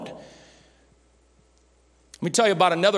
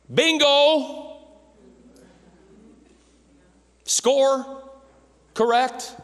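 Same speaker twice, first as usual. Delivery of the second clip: higher, bright quiz-host call; game-show bingo; 10 semitones higher, 4 dB louder, much slower than usual. Acted. Pastor answering a congregant, mock game-show cheer.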